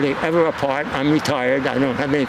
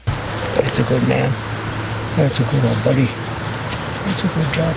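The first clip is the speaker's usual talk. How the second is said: talking to a dog; slower than usual. Real, not acted. Owner cooing to his dog.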